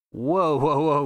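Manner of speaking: normal talking voice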